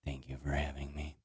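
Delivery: very low, soft creepy murmur. Acted. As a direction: say it eerily hushed. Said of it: "that was extremely creepy".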